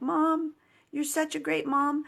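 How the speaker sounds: put-on playful child voice cheering mom on; cheering kids. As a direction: mimic her kids cheering her on.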